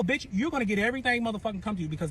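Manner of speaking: ordinary talk, nothing marked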